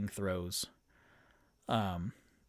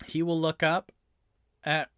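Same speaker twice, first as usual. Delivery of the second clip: ordinary talk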